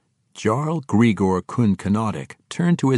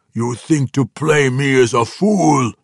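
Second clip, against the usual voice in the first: louder, higher menacing growl; growling orc warlord; 4 semitones higher, 6 dB louder than usual. A narrator's reading. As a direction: growl it at an impudent prisoner. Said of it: "he said with a growl".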